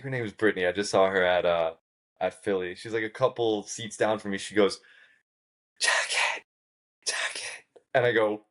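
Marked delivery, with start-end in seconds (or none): 5.8-7.6 urgent voiceless call for attention; hissed whisper. Retold or imitated. Girl a couple seats down, urgent whisper. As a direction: whisper sharply to someone seats away.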